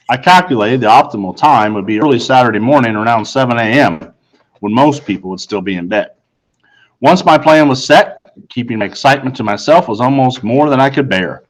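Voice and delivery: ordinary delivery, nothing marked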